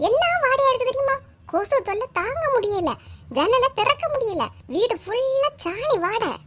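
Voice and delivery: high, strained grievance; shrill complaint. Acted. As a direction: pile on the complaints. Complaining about the dung smell.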